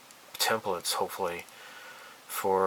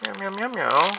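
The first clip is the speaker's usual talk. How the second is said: high, loud cooing meows; cooing at kitty; 9 semitones higher, 4 dB louder than usual. Pretend. Vlogger cooing over a cute kitty model, high. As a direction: coo at it like a kitten.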